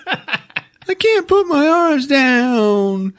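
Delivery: put-on very high, drawn-out little-boy whine; mock whining kid. Overdressed boy to his mom, mock whining. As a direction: whine it high and slow like a small child.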